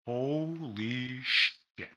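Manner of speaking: slow, soft, stunned "Holy shit"; awed holy shit. Retold or imitated. Watching Japanese wrestling for the first time, quietly awestruck. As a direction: let it out slowly, in quiet awe. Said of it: "and I'm like".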